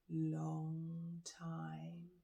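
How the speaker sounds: ordinary delivery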